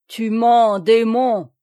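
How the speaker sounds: loud, enraged accusation; furious yell. A narrator's reading. Guy de Plélan calling Noël a liar, furious yell. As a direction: hurl it at the enemy. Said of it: "hurla Guy de Plélan".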